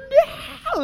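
very high mock squeal; freaking out. Rescued students celebrating like lottery winners, mock freak-out. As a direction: imitate kids going wild with joy. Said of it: "it was, like, freaking out".

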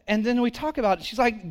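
ordinary delivery

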